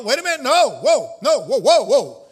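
loud rising calls to calm the crowd; excited loud whoa. Real, not acted. Preacher reining in an excited congregation, loud calls. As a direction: hold them back, loud and urgent.

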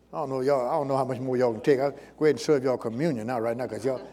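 soft, low, offhand aside to the congregation. Real, not acted. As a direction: drop out of preaching into a quiet aside. Aside to congregation before communion.

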